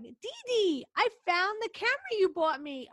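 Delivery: put-on high, excited child voice; imitating young nephew. Young nephew tells aunt about the camera, high and excited. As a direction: announce it proudly like a little boy. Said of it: "he was like".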